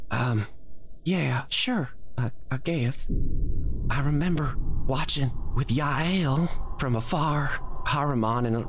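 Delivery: put-on high, gentle young oliphant voice; a shaken girl calming down; Lulu the young oliphant. Lulu reassuring Corbin after her nightmare, high gentle voice. Lulu recounting Zariel's fall.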